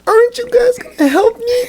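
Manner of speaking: soft pleading voice. Retold or imitated. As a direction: plead for help in a tiny high voice, like a hurt Karen. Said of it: "in a soft voice".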